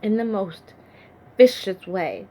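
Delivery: loud, forceful emphasis; anguished outburst. Acted. David confessing how he killed a man, loud and forceful. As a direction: hit it hard, appalled at the deed. David confronts his violent act.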